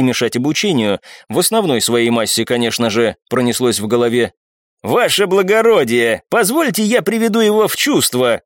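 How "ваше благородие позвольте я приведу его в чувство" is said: higher-pitched, mildly angry request. A narrator's reading. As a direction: ask leave with a grudge. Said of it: "зло сказал Левинши".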